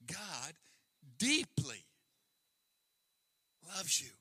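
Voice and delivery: deeply lifted and held, each word spaced out; God, pause, deeply, pause, loves you; stress on deeply. Shown as a demonstration. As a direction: lean into "deeply" and pause around it. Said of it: "real spiritual mileage out of that".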